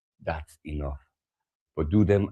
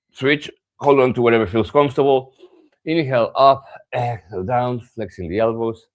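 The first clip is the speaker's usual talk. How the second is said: louder, higher instructor cues; class cue voice; 6 semitones higher, 6 dB louder than usual. Acted. Yoga teacher calls out cues to the class, louder and higher. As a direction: call out the stretch to the class.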